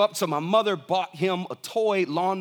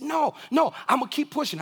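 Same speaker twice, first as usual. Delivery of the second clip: put-on high, insistent little boy voice; stubborn toddler; 7 semitones higher than usual. Father voices three-year-old son.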